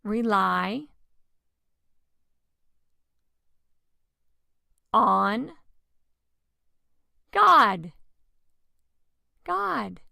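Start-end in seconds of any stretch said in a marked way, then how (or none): 0.0-7.9 rely, on, God spaced out and rising to a loud God; drawn out, growing louder to God; building to a shout. Acted. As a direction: build slowly and land hard on God. Dramatic slow build to God.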